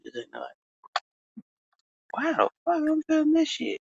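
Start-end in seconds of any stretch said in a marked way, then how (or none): 2.1-3.8 bewildered. Retold or imitated. Retelling his reaction to cold draft.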